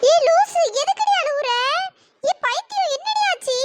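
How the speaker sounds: worried, high-pitched friendly scolding; alarmed scolding. Acted. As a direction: demand to know why your friend is crying. Alarmed friend questions crying girl.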